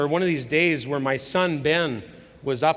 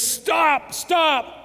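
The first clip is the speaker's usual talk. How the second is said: much higher and louder, cry out to halt it; urgent shout; 5 dB louder than usual. Acted. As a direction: shout stop with full urgency. Chief halts the whipping.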